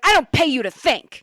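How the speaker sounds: retold yell. Boss yelling at worker who blames the system, loud yell. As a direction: spit it out like a furious boss. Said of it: "he starts yelling at her".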